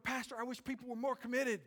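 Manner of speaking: high-pitched imitation of a church member; pleading to the pastor, high and put-on; mock complaining congregant.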